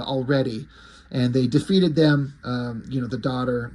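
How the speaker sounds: usual speaking voice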